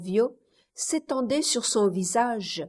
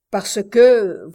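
a much louder, hard-hit "Parce que"; brutal retort; 11 dB louder than usual. A narrator's reading. Tiburcio cuts Kella off, brutal and loud. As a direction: slam the words down on her. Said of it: "répondit brutalement".

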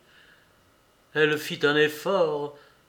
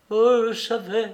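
raised, louder cry of grief held back; lightly voiced voice breaking with tears; mild tearful gasp of confession; a touch of broken sobbing; 6 semitones higher, 5 dB louder than usual. A narrator's reading. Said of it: "d'une voix brisée par les sanglots".

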